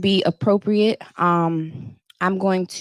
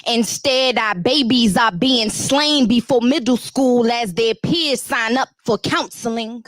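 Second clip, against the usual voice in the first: spoken word delivery, a touch higher and driving; spoken word poem; 4 semitones higher than usual. Acted. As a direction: perform it like a slam poem to the crowd. Poem performed for the crowd.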